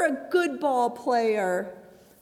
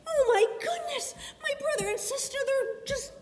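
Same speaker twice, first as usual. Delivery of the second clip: high upset voice; 9 semitones higher, 3 dB quieter than usual. Acted. Sarah distressed at siblings fighting.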